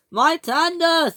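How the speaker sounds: slow, high wail; distressed cry. A narrator's reading. Ferdinand sees his tender tumble away, distressed cry. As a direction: cry out for what is lost.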